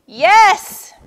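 high, very loud cry; screamed. A narrator's reading. Turtle seizing on Lion's suggestion, screaming. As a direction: scream agreement at full pitch. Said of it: "screamed Turtle".

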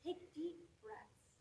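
slow, high, soft mock soothing; softly mocking imitation; mimicked advice. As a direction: parrot calming advice, drawn out. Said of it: "they say".

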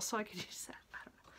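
breathy whisper, almost no voice; a little whispered afterthought, half laughing; whispered aside. Real, not acted. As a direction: drop to a secret whisper as a side comment.

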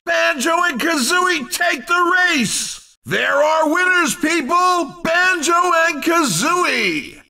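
high-pitched, excited race announcer; big excited announcer shout; triumphant winner call. Acted. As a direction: proclaim the champions to the crowd.

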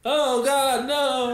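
high, loud laughing "no"; trained panic scream. Acted. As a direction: shriek on cue at the honk. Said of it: "I made the noise".

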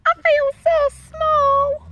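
very high, loud, slow little voice; tiny snail voice. A narrator's reading. Snail confides in the whale, high tiny voice. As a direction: squeak it slowly as a tiny creature. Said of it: "Then she said to the whale".

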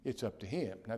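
ordinary talk

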